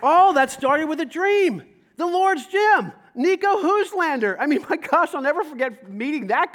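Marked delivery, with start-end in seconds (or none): 0.0-4.4 slow, loud, higher proclamation; rising preacher's cry. Real, not acted. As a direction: call it out to the whole room. Preacher builds to a climax.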